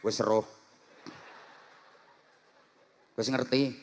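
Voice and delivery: low, soft offhand answer; lazy casual reply. Retold or imitated. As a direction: shrug it off. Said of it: "Masa kok jawabnya".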